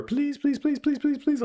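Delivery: high, rapid-fire imitated pleading; begging kids. All the kids pleading on the phone, high-pitched begging. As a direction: mimic children begging all at once. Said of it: "all of them are".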